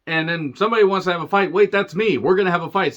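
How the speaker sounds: mock Owens. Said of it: "Then he says".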